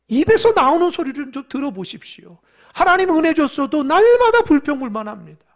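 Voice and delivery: loud, high acted protest to God; exasperated pleading. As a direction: play a leader crying out his complaint. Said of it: "모세도 믿음이 무너집니다". (하나님께 높여 호소하는 모세 연기)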